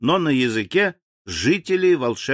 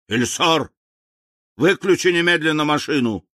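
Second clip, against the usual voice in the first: angry order; 4 semitones higher than usual. A narrator's reading. Angry general orders his servant.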